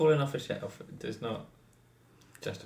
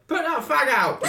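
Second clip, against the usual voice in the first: high laughing yell; shouting; 7 dB louder, slower than usual. Retold or imitated. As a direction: yell it across the pub. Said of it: "someone would be like".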